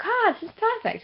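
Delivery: high-pitched blissful sigh of approval; contented delight. Acted. Brit acting out joy at sunny breezy weather, mock delight. Acting out Brits pleased by sunshine.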